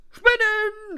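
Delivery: very high, loud shriek; screamed. A narrator's reading. Guest discovering what is in the room, screaming. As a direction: shriek it out in alarm. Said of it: "schrie Herr Schnarch".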